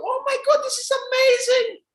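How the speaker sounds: very high, loud, sobbing joy; mock tearful mother. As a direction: cry it out like an overjoyed mom. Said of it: "She starts crying".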